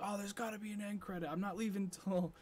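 loud, high-pitched excited fan; cheering moviegoer. Retold or imitated. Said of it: "like, everyone cheering on".

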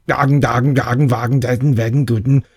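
gibberish in a hammed-up German accent; mock German. Teasing a date about how Germans speak, mock German accent. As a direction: bark fake German words to make her laugh. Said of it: "do you know how Germans speak".

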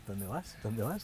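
low, hushed repeated query; baffled bystanders. Retold or imitated. The people around him as he leaves, imitated in a murmur. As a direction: imitate onlookers muttering after him. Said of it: "Y la peña de su alrededor".

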